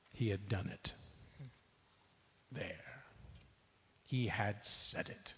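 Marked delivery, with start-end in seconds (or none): 2.5-3.0 whisper. Acted. Quiet satisfaction after the warning.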